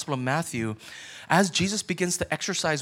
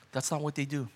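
ordinary talk, nothing marked

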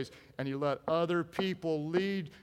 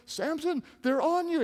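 high woman's call; half-hearted falsetto alarm; Delilah's warning; 8 semitones higher than usual. Acted. Preacher as Delilah warning Samson, high call.

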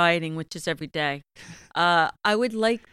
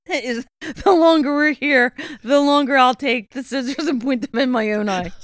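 laughing while talking; 7 semitones higher, 9 dB louder than usual. Real, not acted. Joking complaint, laughing.

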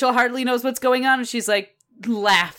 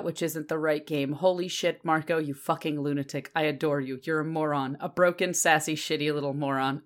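softer, lower muttered note reading; 6 semitones lower, 6 dB quieter than usual. Shown as a demonstration. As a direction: read the notes aloud under your breath. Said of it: "That was my notes".

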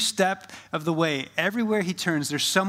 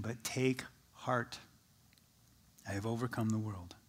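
hushed reassurance; 4 semitones lower, 10 dB quieter than usual. Acted. Jesus before his death.

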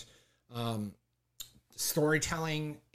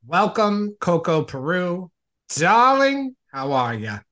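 gushing welcome; 6 semitones higher, 13 dB louder, slower than usual. Acted. Host welcoming drag icon with Darling.

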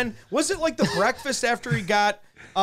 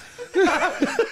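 imitated laugh; 9 semitones higher than usual. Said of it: "He's like".

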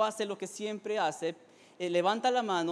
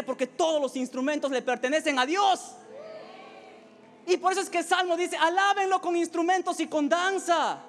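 emphatic, raised pulpit voice; impassioned preaching; 7 semitones higher, 5 dB louder than usual. Acted. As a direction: proclaim each verse to rouse the congregation. Preaches at full force.

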